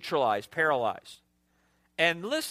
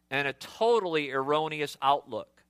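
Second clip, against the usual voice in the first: usual speaking voice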